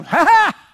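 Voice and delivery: sudden high loud "ha ha" burst; mock holy laugh. Imitating the lady who laughed in church. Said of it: "she'd go".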